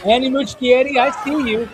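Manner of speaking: high, loud gleeful shout-out; excited shout-out. Retold or imitated. Giving the director a shout-out while watching, gleeful. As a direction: call out the director with a knowing grin. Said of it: "I was like".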